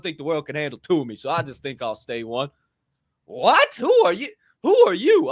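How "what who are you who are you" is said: high, loud stunned "who are you"; amazed stranger. Retold or imitated. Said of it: "stranger reacts, amazed".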